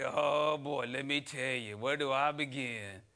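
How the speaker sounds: slow, sagging complaint voice; weary moaning coworker; Debbie Downer. Retold or imitated. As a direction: drag out the gloom like a sigh. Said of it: "Debbie Downer".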